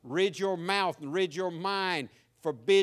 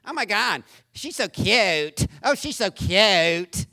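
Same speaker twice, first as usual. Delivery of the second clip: mock Valley girl gushing, slowed and drawn out, louder; 4 dB louder, slower than usual. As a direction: imitate a gushing teenage girl, stretching the words. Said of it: "Becky said".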